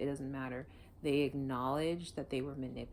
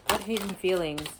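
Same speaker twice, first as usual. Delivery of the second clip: asks the spirit again, much louder; louder retry; 4 semitones higher, 8 dB louder than usual. Shown as a demonstration. Reader repeats question louder to the spirit, loud. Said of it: "Let's try it again".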